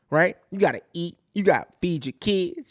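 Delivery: usual speaking voice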